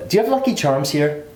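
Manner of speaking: high-pitched stage-Irish accent; hammed-up Irish accent; leprechaun Irish accent. Shown as a demonstration. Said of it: "they always want that leprechaun".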